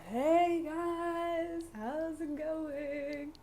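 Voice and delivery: drawn-out high, sing-song voice; high-pitched, singsong impersonation; Nemo. Acted. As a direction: put on an annoying voice, stretching every word high and slow. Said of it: "that's, like, how he talks".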